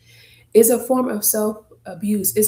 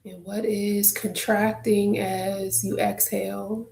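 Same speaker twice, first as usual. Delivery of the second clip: guided meditation; 6 dB quieter than usual. Acted. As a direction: guide listeners gently through their breath, leaving space. Leads a breathing meditation.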